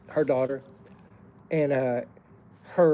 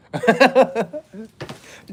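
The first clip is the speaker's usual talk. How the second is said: loud high laugh; burst of laughter; 7 semitones higher, 8 dB louder than usual. Real, not acted. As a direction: let a big laugh break out. Laughs at the kiss.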